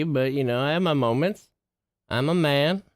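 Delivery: ordinary delivery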